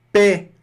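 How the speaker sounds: Pé said high and loud, ai as è, x silent; French paix. Shown as a demonstration. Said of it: "A mais I fica é".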